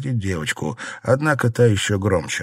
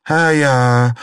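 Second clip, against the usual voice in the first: loud, higher, held outcry; exclamation; 5 semitones higher, 6 dB louder than usual. A narrator's reading. Wife reacts to the birthday news, exclaiming. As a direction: exclaim as it dawns on you. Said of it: "воскликнула Фэнзе".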